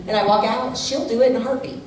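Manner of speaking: ordinary talk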